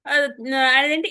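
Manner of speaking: ordinary delivery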